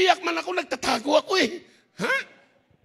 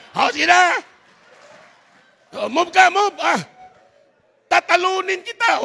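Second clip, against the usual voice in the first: playful challenge; 5 semitones higher, 8 dB louder than usual. Acted. Challenging wife to chess game.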